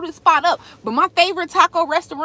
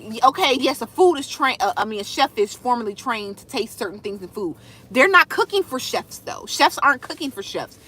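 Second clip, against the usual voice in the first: ordinary delivery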